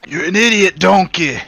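loud, high ogre scolding; Shrek impression. Gamer insulting teammate in movie character, Shrek voice. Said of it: "I turn into Shrek".

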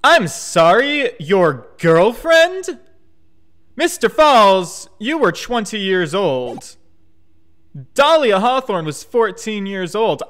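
loud, drawn-out incredulous questioning; appalled disbelief. Real, not acted. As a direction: stretch the words slowly, loudly, in disbelief. Reacts appalled to girlfriend's age.

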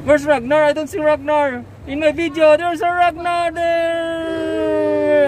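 high, loud sing-song asking; playful high call. Real, not acted. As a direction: playfully look for the pet. Playful search for pet Ragnar.

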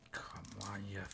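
soft whispered effort; strained whisper. Real, not acted. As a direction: hiss it under your breath while straining. Struggling to open pack.

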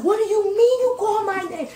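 angry high-pitched disbelief; mad. Retold or imitated. To the airline desk staff, outraged high-pitched protest. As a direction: demand it, voice jumping way up in outrage. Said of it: "but I was mad".

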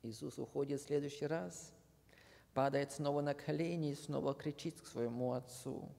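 usual speaking voice

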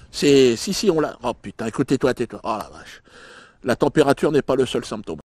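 ordinary delivery

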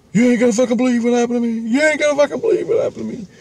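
frantic high, rapid gabble, imitating a panicked friend; freaking out. Friend bursting in from the snowstorm, freaking out. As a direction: retell her freak-out in a high, racing voice. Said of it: "she's freaking out. She goes".

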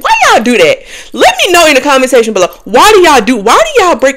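calling out viewers. Real, not acted. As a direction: demand an answer from the viewers.

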